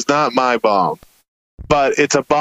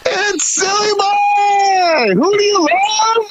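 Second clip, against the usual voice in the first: high, slow, shouted hype-man voice; Silly Boy stage persona; much slower than usual. Retold or imitated. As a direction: bellow the silly character's entrance. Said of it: "I walk on stage and I go".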